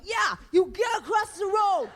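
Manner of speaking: high, loud cheeky yell; mocking the cop's order; mock shout. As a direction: yell it back, joining in. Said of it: "And I'm like".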